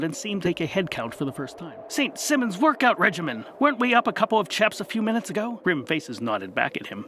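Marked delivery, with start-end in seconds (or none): 1.9-5.6 high, loud and quick; startled exclaim. Acted. Dusty noticing missing friends, high and hurried. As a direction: realise half the party is gone.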